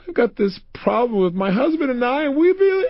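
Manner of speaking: high-pitched, wailing voice of an upset woman; mock crying. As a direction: imitate her breaking down into tears. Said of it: "she began to cry like crazy".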